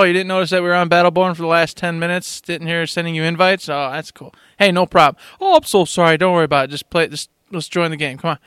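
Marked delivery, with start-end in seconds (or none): none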